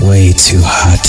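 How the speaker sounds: ordinary delivery, nothing marked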